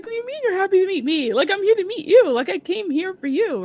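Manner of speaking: high-pitched, emphatic disbelief; incredulous protest. Retold or imitated. Fan answering the star she came to meet, incredulous. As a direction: protest in amazement, voice pitched right up.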